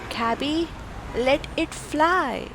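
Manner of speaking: ordinary delivery, nothing marked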